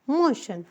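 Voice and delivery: मोशन said slowly as "mo-shan", ending on a fall; teaching pronunciation. Shown as a demonstration. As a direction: spell out मोशन, then land it with a falling tune. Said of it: "मोशन लिखा है". (मोशन को धीरे से "मो-शन" बोलें, अंत में स्वर गिराएँ)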